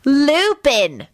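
"Lupin" pronounced slowly and distinctly; clearly enunciated. A narrator's reading. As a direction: enunciate "Lupin" with great precision. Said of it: "enunciated as clearly as Snape".

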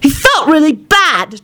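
slow, high, stressing felt; mild childish snap; snapping child. A narrator's reading. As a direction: snap it back at John. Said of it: "snapped at John".

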